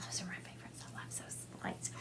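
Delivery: very soft, voiceless whisper; hushed, confiding whisper; whispered aside. Real, not acted. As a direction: whisper it as a delighted little aside. Aside to viewers after thanking them.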